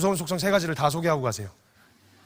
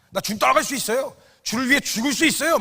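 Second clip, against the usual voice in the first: higher, fervent vow; eager pledge; 7 semitones higher than usual. Retold or imitated. Fervent Peter vowing to follow the Lord to death. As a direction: pledge it with burning zeal.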